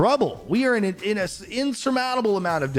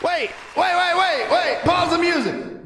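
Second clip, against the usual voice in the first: excited shout; 11 semitones higher than usual. Real, not acted. Surprise raid on stream.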